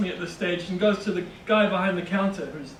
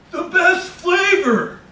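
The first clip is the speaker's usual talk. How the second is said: high, loud, drawn-out gushing stranger; enthusiastic stranger; 11 semitones higher, 9 dB louder, much slower than usual. Retold or imitated. Bodega stranger praising the narrator's order, high and loud. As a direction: gush over the sandwich like an overeager local.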